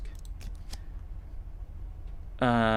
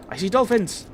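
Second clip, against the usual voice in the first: excited; 9 semitones higher, 4 dB louder than usual. Acted. Distraction trick during the quarrel.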